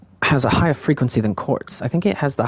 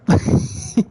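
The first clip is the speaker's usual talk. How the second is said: short laugh. Real, not acted. Laughs after saying oh shit.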